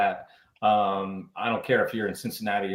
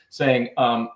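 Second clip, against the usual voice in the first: normal talking voice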